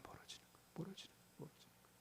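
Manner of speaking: whispered, fading repetition; echoing whisper. Real, not acted. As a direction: let the words fade into a whisper. Whispered echo of sermon point. ("멀어지는 거예요"를 점점 사라지는 속삭임으로)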